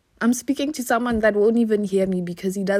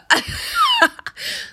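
a sudden high squeal of laughter; shrieking laugh. Real, not acted. Podcaster laughing at her free-water logic, high shriek. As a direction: burst into a shrieking laugh at yourself.

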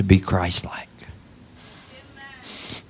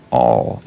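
"all." dropped low and held; stress on all; 11 semitones lower than usual. Shown as a demonstration. Preacher stressing "all." to the congregation, low and weighty. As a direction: lean hard on "all.". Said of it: "Emphasis on the word all".

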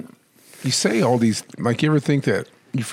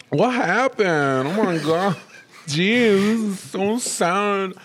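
much higher, slow, strange put-on voice; hypothetical new voice after coma; 10 semitones higher, much slower than usual. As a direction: wake up talking in a high odd voice, dazed. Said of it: "Why am I talking like this?".

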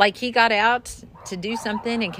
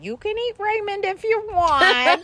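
mimicking; 13 semitones higher, 3 dB louder than usual. Quoting voice on radio.

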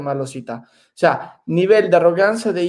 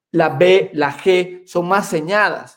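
ordinary delivery

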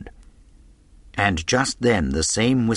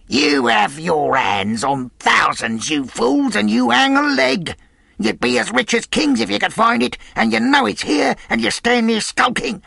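high, loud furious harangue; desperate villain railing at the gang; raging tirade; 11 semitones higher, 4 dB louder than usual. A narrator's reading. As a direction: shame the cowards into action. Pew berates his irresolute gang.

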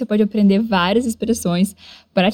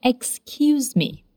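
slow model for repetition; 2 semitones higher, 5 dB quieter, much slower than usual. Shown as a demonstration. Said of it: "Repete comigo".

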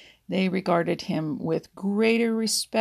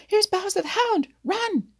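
much higher, loud warning scream; screaming alarm; 13 semitones higher, 6 dB louder than usual. A narrator's reading. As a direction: crow shrieking a mischievous alarm. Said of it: "screamed Blacky the Crow".

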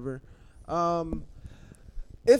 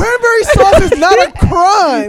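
outraged shout; 13 semitones higher, 13 dB louder than usual. Real, not acted. Defending a food against the others, outraged shout. Heated mock argument over food.

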